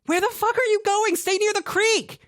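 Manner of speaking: very high, loud yell; desperate yell. A narrator's reading. Cassidy screaming after Grant, very high and loud. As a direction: scream after him into the woods.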